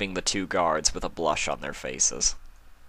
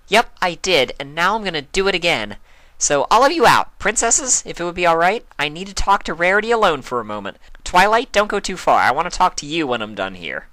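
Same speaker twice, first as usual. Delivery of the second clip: high, loud brisk orders; bossy Pinkie; 11 semitones higher, 7 dB louder than usual. A narrator's reading. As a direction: shoo everyone out. Pinkie clears the room.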